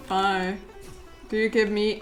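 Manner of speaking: ordinary delivery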